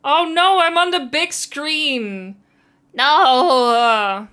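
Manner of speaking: loud, high, drawn-out "oh no" of real embarrassment; embarrassed outcry. Real, not acted. Streamer sees herself on the big screen downstairs, loud embarrassed outcry. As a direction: cry out, caught on the big screen.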